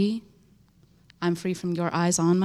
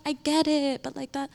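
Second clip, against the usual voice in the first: mock sympathetic friend; 5 semitones higher than usual. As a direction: play the friend brushing it off. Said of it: "or like".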